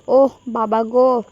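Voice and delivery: drawn-out mock wail of pain; pained cry. A narrator's reading. Hayat bumps her head at the door, mildly acted pained cry. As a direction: slowly groan it out, mildly hurt. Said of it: "আর্তনাদ করে উঠল".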